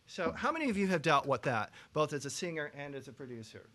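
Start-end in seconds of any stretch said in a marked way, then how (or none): none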